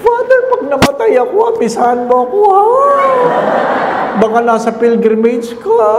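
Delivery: a grandmother charmed and chuckling; high laughing elderly voice; delighted old lady. Retold or imitated.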